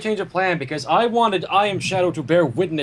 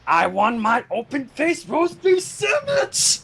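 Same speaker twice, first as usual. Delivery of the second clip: mock whiny demand; 5 semitones higher, slower than usual. Silly demanding outburst.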